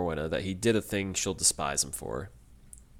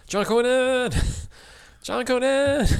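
high, loud mock shout of a name; imitated shouting; 5 dB louder, slower than usual. Retelling Lady Whitworth searching for her grandson, hammed-up high shout. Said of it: "she just repeatedly shouts".

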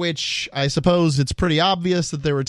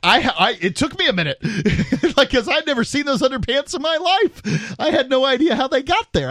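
laughing; 9 semitones higher than usual. Real, not acted. Laughing recounting underpants story.

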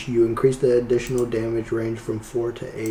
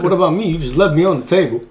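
raised, higher-pitched protest; loud protest; 6 semitones higher, 9 dB louder, much faster than usual. Pretend. Player left on the table calls out, loud protest. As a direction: call out that you've been forgotten.

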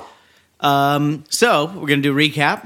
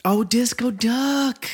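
high-pitched; 11 semitones higher, much slower than usual. Real, not acted. Repeating odd song title.

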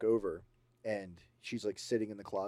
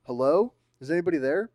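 loud, higher questioning call; calling into darkness; 7 semitones higher, 11 dB louder than usual. Acted. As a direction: call out down a dark hallway. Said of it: "so he goes".

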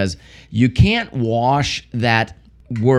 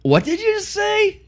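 very high, slow disbelief; stretched-out squeal of incredulity; mock shock; slower than usual. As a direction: act out a scandalized grandpa. Acting out grandpa's shocked reply to boy.

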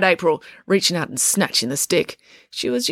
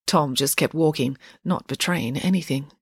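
ordinary delivery, nothing marked

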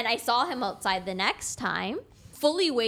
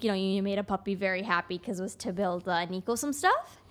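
ordinary talk, nothing marked

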